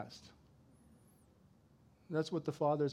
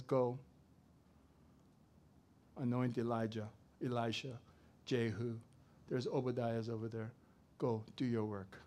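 lower, softer voice of God; calm instruction; 6 semitones lower, 4 dB quieter than usual. Acted. God sending Elijah out, calm and soft. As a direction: settle down and give the order gently.

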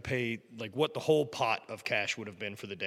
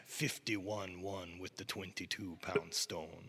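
put-on slow, soft Scottish accent; a hint of an old Scot's brogue; Scottish man recalling a stone throw; 3 semitones lower, 7 dB quieter, much slower than usual. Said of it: "you do the accent".

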